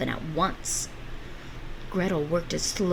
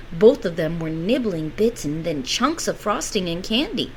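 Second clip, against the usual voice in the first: ordinary delivery, nothing marked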